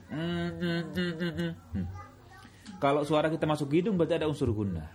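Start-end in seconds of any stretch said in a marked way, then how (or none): 0.1-1.5 humming through the nose; nasal humming. Shown as a demonstration. Teacher showing students nasal ghunnah hum. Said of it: "suara ke hidung".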